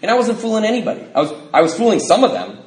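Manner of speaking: ordinary talk, nothing marked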